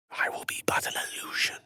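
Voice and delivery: fast whispered incantation; quick spell whisper. Acted. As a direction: hush it fast as you vanish. Casting an invisibility spell.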